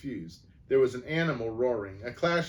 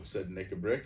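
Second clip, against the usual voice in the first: usual speaking voice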